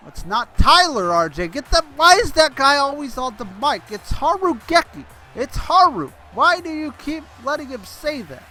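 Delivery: loud, high-pitched indignant rant; exasperated shouting. Real, not acted. Commentator berating colleague over wrong name and graphic, exasperated shout. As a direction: yell your complaint at a bungling producer.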